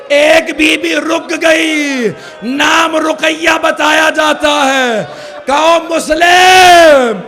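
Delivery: chanted Urdu majlis lament, loud and drawn out; preaching at full force. Acted. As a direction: wail the tale out to the mourners.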